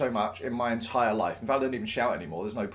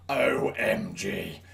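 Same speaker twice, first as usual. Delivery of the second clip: slow, drawn-out, lower-pitched O M G; stunned reaction; 6 semitones lower, 3 dB quieter, much slower than usual. Retold or imitated. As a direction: spell it out slowly in awe. Stunned reaction to mentor's advice.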